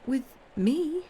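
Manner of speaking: high, incredulous rising question; can't believe it's aimed at you; astonished young woman, pitched right up; astonishment. A narrator's reading. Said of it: "Valentine said in astonishment".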